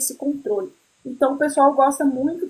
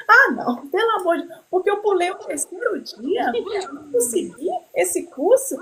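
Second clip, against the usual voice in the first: high, dismayed complaint; pitched-up exasperation at missing the course; exasperated; 7 semitones higher than usual. Real, not acted. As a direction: friend got the course, she vents in high dismay. Exasperated over losing the course.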